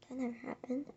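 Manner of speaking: quick unvoiced whisper; a whispered self-correction to the camera; whispered aside. Real, not acted. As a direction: slip it in under your breath. Hushed aside, correcting herself.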